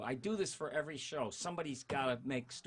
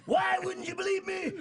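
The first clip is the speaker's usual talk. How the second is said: loud, high, blustering Big Daddy voice as Ren; Big Daddy impression; 9 dB louder than usual. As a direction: bellow it like a Southern patriarch losing his temper. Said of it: "he talked like Big Daddy".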